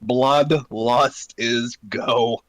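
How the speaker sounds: slow war cry. Acted.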